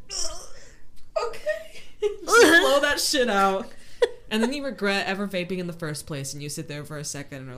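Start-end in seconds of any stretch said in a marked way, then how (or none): 0.1-1.8 strained laughing ugh; laughing groan. Retold or imitated. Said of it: "you just have to be like". 2.0-3.7 slow, loud, laughing. Retold or imitated. Laughing after vape story.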